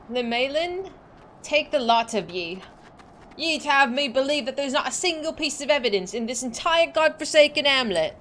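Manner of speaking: a hint of a salty pirate accent. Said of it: "I don't know what this accent is".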